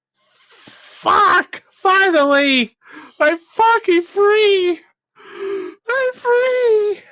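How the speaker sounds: high, slow, jubilant shouting of relief; elated cry. Real, not acted. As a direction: yell it out like you've just escaped. Celebrating finally being free.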